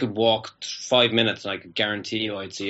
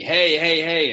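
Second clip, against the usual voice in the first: mock police shout, raised and higher; retold shout; 6 semitones higher, 4 dB louder, slower than usual. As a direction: imitate a policeman calling out to stop someone. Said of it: "started shouting at me".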